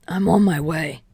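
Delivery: lightly voiced gruff hero; a restrained in-character reply; Dynamite responding. A narrator's reading. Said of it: "Dynamite responded".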